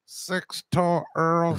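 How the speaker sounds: "Earls" said for oils, drawn out slowly; mock mispronunciation. Joking the caller said "Earls", slow and drawn out. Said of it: "I wish he said Earls".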